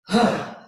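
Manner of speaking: loud, forceful exhaled huh; powerful huh. Shown as a demonstration. Leader exercising the group's voices, forceful huh. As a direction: push the breath out in one burst. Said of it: "with a powerful".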